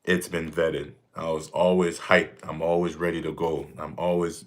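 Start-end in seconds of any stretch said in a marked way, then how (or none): none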